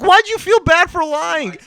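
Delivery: high, loud, exasperated protest; incredulous retold rebuke. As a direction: scold a friend in disbelief. Retold scolding of his honest friend.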